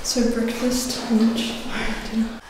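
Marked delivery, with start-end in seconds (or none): none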